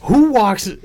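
high, loud mock indignation; indignant challenge. Retold indignant challenge to uninvited neighbor.

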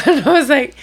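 laughing through the words; laughing at her own reaction. Real, not acted. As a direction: chuckle into the line. Laughing while retelling.

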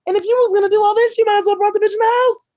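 loud, high, heated ranting; shrill-edged rant of disbelief at the driver. Retold or imitated. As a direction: let the outrage boil over, high and loud. Ranting about the parked car.

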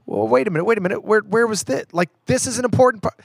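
mock dismay. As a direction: act out a fan noticing a cut scene. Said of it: "you're like".

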